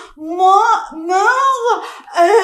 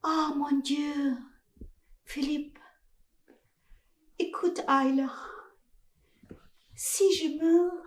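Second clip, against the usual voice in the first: soft, much quieter heartfelt confession; 4 semitones lower, 10 dB quieter than usual. Acted. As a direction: murmur it low, as a last wish. Dying confession about Philippe.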